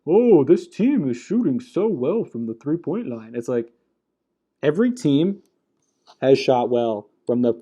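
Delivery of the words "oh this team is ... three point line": mocking the announcers. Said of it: "they're just like".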